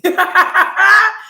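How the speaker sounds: high, loud burst of laughter; amused laugh. Real, not acted. Laughs at the retold comeback.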